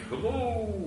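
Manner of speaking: high, soft, drawn-out ghost moan; ghostly voice. Acted. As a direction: float it eerily like a mock ghost. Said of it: "sounds kind of like".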